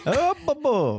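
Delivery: very high Italian-lilted howl; Italian husky howl. Retold or imitated. Imitating the husky from the clip, Italian howl. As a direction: howl along like an Italian dog. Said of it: "the husky in an Italian accent".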